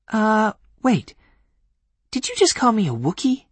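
put-on young woman voice. Voicing Granuaile.

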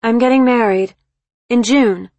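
high, loud sudden announcement; blurted out. Acted. Daughter phoning mother with wedding news, blurted out. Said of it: "Instead, she blurted out".

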